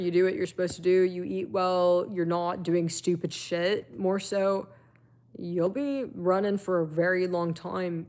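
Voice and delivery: ordinary talk, nothing marked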